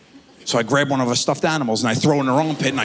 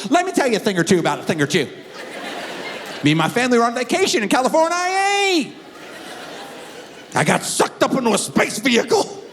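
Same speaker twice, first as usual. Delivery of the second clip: high, hammed-up Alabama twang; Alabama accent; 9 semitones higher than usual. Grown son telling his alien abduction tale, broad mock Alabama accent. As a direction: play a banjo-picking Alabama yokel. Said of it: "Apparently he's moved to Alabama".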